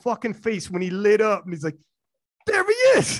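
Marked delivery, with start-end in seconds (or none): 2.5-3.2 high, loud joyful call of recognition; excited greeting. Retold or imitated. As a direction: shout it out like spotting an old friend. Friend lights up seeing him.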